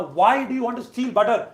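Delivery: high, loud exasperated question; scolding mother. Acted. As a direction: demand an answer from a misbehaving son. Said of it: "always wanted to ask Krishna".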